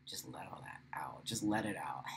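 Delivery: breathy whisper, very soft and low. Real, not acted. Guiding viewers through a release, whispering. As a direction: breathe it out to the group.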